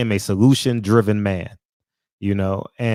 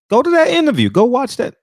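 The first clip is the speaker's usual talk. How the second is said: much higher and louder, pushing it out; loud urging; 12 semitones higher, 6 dB louder than usual. Real, not acted. Streamer urging his chat, loud push to watch. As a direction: insist they go watch it.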